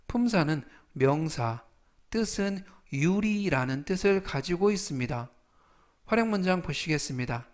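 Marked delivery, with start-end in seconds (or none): none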